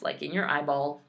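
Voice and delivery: normal talking voice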